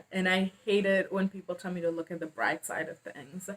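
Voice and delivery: ordinary talk, nothing marked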